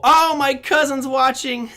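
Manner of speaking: high, loud, drawn-out happy exclamation; delighted excitement. Real, not acted. Host spots his cousin watching, excited delight. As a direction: burst out delighted, breaking into a laugh.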